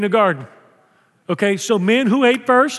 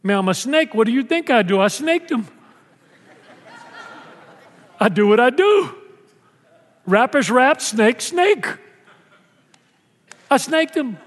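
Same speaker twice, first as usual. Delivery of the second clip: casual snake; 4 semitones higher than usual. Acted. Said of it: "He goes".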